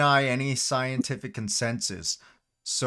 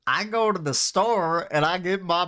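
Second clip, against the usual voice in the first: higher-pitched, indignant country voice; Kevin; 6 semitones higher than usual. Acted. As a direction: protest like a hammed-up beef-loving yokel. Kevin character objects to chemicals.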